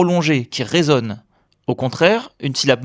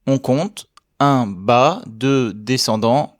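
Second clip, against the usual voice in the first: counting each mark slowly; slow, careful teacher count; 2 semitones lower, much slower than usual. Shown as a demonstration. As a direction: spell the marks out slowly. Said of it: "On voit le signe numéro trois".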